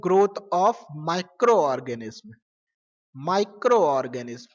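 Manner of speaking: dictating, very slowly; slow dictation. Shown as a demonstration. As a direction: draw the word out for notes. Teacher dictating a definition.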